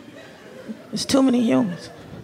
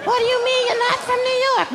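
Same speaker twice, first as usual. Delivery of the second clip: high, slow mock witch voice; witchy New Yorker; 11 semitones higher than usual. A Yankee quizzing the outsider, high mock witch voice. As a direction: cackle the question like a witch. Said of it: "sound like witches".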